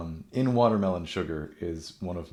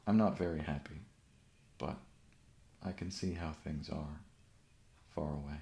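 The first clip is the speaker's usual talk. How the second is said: measured recital with pauses between phrases; understated, spacious poem reading; poetry reading; 5 dB quieter than usual. Acted. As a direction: read it as verse, fading softly at the close. Reads a poem aloud.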